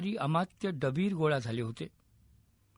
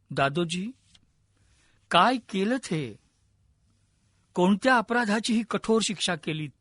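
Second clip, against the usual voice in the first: sobbing outburst; 4 dB louder than usual. A narrator's reading. King grieving over his dying mentor, mildly acted sobbing outburst. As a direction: plead in grief, voice pushed up a little. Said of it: "राजांना हुंदका फुटला".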